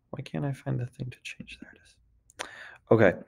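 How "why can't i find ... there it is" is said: whispered aside to herself; whispered to self. Real, not acted. Teacher muttering to herself while hunting on screen, whispered. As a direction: whisper it under your breath while searching.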